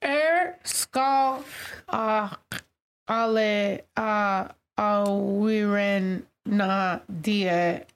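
slow, hesitant reading of unfamiliar Celtic words; halting sounding-out. Real, not acted. Reading a Celtic saying to co-hosts, slow and halting. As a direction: sound out "awiren na die" syllable by syllable.

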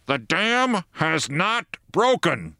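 megaphone call. A narrator's reading. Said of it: "bawling through megaphones".

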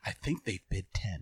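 low, soft field commentary; nature documentary observer. Pretend. Joking about watching spades from the underbrush, low hushed documentary observer. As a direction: observe the players quietly, pitched low. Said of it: "it's like not National Geographic".

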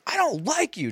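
a quick mimic of a sulking kid; mock kid whine. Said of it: "you just can't just go around".

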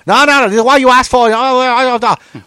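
high, fast, loud mock scolding; jumping down your throat. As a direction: cut the customer off in a rapid tirade. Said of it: "they jump down your fucking throat".

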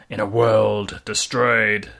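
trailer narrator voice. Acted. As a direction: intone it like an epic trailer. Mocking movie trailer voice.

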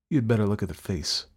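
usual speaking voice

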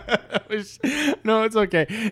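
high laughing through words; giggling apology. Real, not acted. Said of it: "It just made me laugh".

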